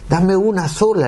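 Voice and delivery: high, imploring request; re-enacted earnest appeal; retold pleading. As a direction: beg God, pitching the voice up. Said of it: "le pedí a Dios".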